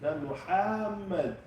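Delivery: slow, high mock "Muhammad"; wrong Muhammad. Shown as a demonstration. Teacher mocks a wrong pronunciation of the name. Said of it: "His name is Muhammad".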